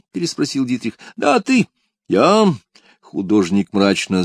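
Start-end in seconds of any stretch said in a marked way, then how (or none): 1.2-2.5 booming warrior king. A narrator's reading. King insists, much higher.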